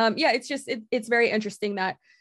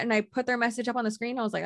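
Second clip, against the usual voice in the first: normal talking voice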